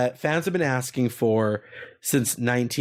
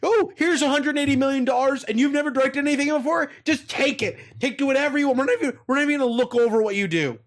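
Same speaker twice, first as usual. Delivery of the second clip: mock executive; 11 semitones higher, faster than usual. Mocking Nintendo executives handing money.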